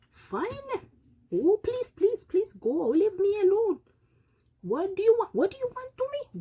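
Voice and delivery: wag a finger and lecture like a self-important elder; scolding wise old man. Acted. Old man scolding a young fool in a high voice.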